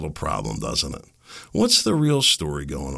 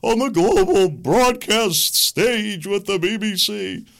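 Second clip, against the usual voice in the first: much higher, slow, snooty mock announcement; mock pompous broadcaster; 14 semitones higher, 4 dB louder than usual. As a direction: parody a grand public radio boast, drawn out. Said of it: "was touted as".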